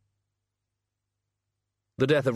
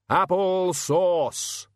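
drawn-out, higher shout; 7 semitones higher, much slower than usual. Acted. Hogfather ordering the boars off, drawn-out shout. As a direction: bellow the magic word slowly. Command to pigs.

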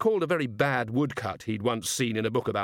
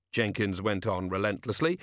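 ordinary delivery, nothing marked

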